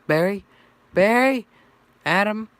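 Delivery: very high, loud, rising questions; querying names. A narrator's reading. Friends check who is on the line, querying loudly.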